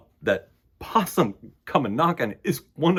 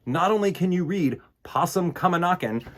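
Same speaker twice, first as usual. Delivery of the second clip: plain reading voice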